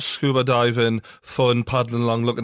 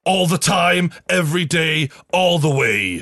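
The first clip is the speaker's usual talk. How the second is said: higher, punchy American promo voice; American accent; 5 semitones higher, 3 dB louder, slower than usual. Pretend. Host improvises sponsor slogans, mock American accent. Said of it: "in an American accent".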